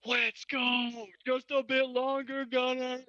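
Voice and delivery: high, drawn-out battle cry; rally a comrade with a long high call; strained heroic call. Acted. Player rallies comrade in character.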